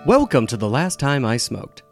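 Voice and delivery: ordinary delivery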